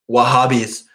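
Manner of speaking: usual speaking voice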